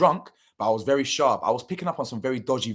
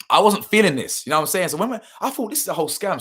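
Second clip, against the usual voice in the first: agitated, pitch jumping higher; worked-up retelling with raised pitch; getting worked up; 7 semitones higher, 6 dB louder than usual. Real, not acted. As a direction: tell it like it's starting to wind you up. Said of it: "I'm getting worked up. You can see I'm getting a bit agitated".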